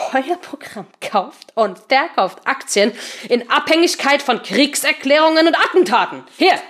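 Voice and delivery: angry. Acted. Seemann angrily confronts traders.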